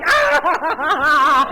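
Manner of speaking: high laughing yelp; a hammed-up shriek of dismay; laughing mock shriek. Told no by the boss, a laughing high shriek. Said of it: "I was like".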